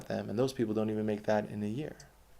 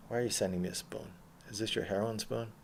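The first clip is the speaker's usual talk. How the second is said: usual speaking voice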